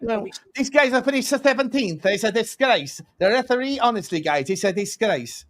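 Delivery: put-on high, outraged Spanish-accented manager; mock Spanish accent sliding into Italian; Arteta. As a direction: protest in a shaky attempt at a Spanish accent. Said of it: "I can't do the Spanish accent as easy".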